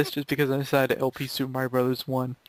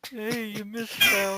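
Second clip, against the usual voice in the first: Cranky Kong impression; 8 semitones higher, much slower than usual. Retelling the gamer's taunt as grumpy Cranky. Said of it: "for Cranky to say every time we screw up".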